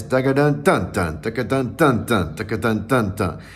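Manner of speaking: rhythmic spoken drum pattern, DUN DUN landing evenly; snare drum rhythm. Shown as a demonstration. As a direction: drum out the syllables like a marching snare. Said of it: "kind of snare drum feel".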